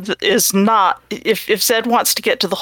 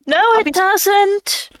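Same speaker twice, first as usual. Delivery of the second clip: character voice; 13 semitones higher, slower than usual. Acted. Player answers in a high, slow, teasing voice.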